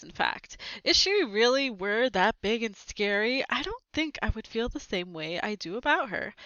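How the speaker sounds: ordinary delivery, nothing marked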